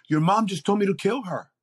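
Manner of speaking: Jesus fed up, reporting the request; exasperated Jesus. Retold or imitated. Jesus telling the speaker about his mom, exasperated. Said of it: "was a little bit exasperated".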